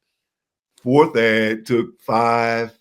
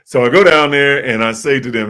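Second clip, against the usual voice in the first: ordinary talk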